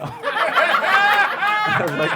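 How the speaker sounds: high, slow laughing through the words. Real, not acted. Comedian recalling being let out, laughing hard. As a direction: crack up while saying it.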